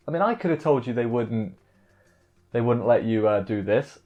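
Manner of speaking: usual speaking voice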